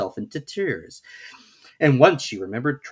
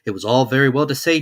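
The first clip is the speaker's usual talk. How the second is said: ordinary delivery, nothing marked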